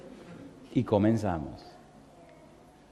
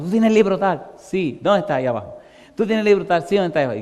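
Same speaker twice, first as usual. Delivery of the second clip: compañeros apurados; 6 semitones higher, 4 dB louder, faster than usual. Retold or imitated. Compañeros preguntan por libros.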